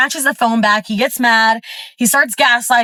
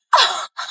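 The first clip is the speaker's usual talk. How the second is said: short laugh; 12 semitones higher, 4 dB quieter than usual. Real, not acted. Laughs at his excuse.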